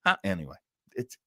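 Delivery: usual speaking voice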